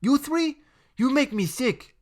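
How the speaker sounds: put-on high, tense defiant boy; a scared but brave boy; brave defiance. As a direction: stand up to them. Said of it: "said Enrique bravely".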